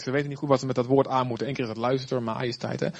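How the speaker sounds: usual speaking voice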